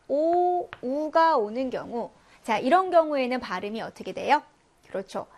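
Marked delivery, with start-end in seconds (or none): none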